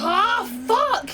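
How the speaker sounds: loud, high burst of frustration; frustrated shout. Real, not acted. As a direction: shout it at the bad roll. Bad roll at the table.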